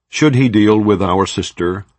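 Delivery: ordinary delivery, nothing marked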